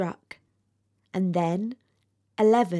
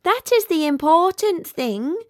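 high, airy fussing voice; fire balloon voice; 8 semitones higher, 5 dB louder than usual. Acted. The fire balloon advising the weeping rocket, high and fussy. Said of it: "said the fire balloon".

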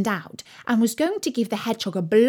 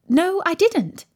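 raised, emphatic contradiction; firm denial; 9 semitones higher, 4 dB louder, slower than usual. A narrator's reading. Alice corrects the Rabbit, firmly. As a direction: deny it in a higher voice.

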